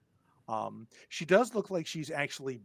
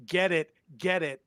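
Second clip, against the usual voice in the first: louder, higher mock screaming; half-hearted panicked scream, raised but controlled; 4 semitones higher, 10 dB louder than usual. Game master voices administrator backed against gate, mock screaming.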